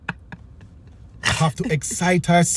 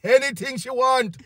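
calling the bartender; 7 semitones higher than usual. Retold or imitated.

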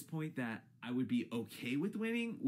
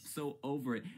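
normal talking voice

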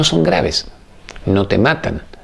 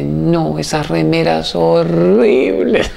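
put-on high, slow scornful old mother; mocking mother; 7 semitones higher, much slower than usual. Mother mocking her son's shirts, high and slow in disdain. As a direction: sneer at the shirts like a disapproving mother.

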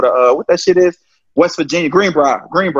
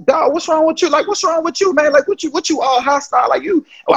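high, heated retold questioning; heated confrontation; 10 semitones higher than usual. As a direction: confront the hostile coach, pitched way up.

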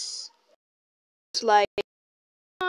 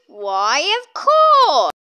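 eager shout; 3 semitones lower, 6 dB louder, slower than usual. Pretend. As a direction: burst out happily. Shopkeeper toy answers eagerly.

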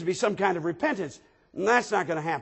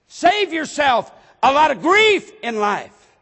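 loud, high, emphatic exhortation; forceful preaching; 6 semitones higher, 6 dB louder than usual. Real, not acted. Preacher urging parents to learn to discipline, loud and forceful. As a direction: press the point home hard on the congregation.

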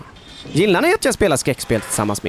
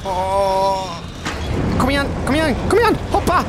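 high, pleading kom igen; urgent urging; 7 semitones higher than usual. Real, not acted. Urging the game character to make the jump, high and tense. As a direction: push them on, desperate.

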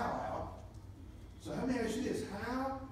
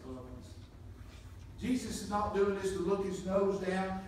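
ordinary talk

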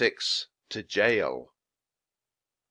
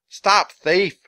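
high, loud accusing shout; yelling; 8 semitones higher, 9 dB louder than usual. Acted. Boy chasing Jack, yelling an alarm. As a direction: shout it down the street. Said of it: "the boy yelled".

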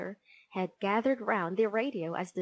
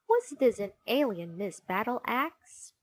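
ordinary delivery, nothing marked

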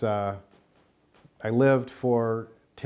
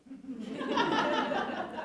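high-pitched laugh. Retold or imitated. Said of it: "So she talks like this".

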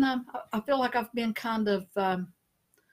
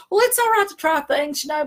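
mock diplomatic; 8 semitones higher, 6 dB louder than usual. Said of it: "I said".

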